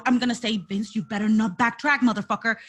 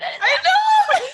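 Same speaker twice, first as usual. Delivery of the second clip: high, loud laughing cry of I know; laughing shout; 6 dB louder than usual. Real, not acted. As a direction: burst out laughing and yelling with joy. Thrilled about the champion.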